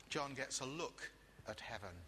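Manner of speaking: usual speaking voice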